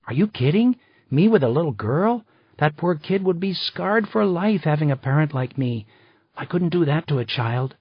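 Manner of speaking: a determined friend announcing her big decision; a hint of a bold, excited woman; Maggie's voice. A narrator's reading.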